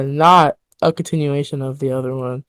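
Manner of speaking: usual speaking voice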